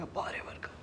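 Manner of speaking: deep, hushed baritone imitation; Amitabh Bachchan voice. Radio hopeful imitating Amitabh Bachchan's famous voice. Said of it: "यहाँ अमिताभ बच्चन वाली voice है".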